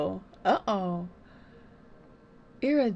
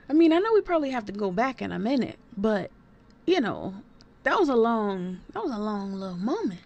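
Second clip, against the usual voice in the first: ordinary talk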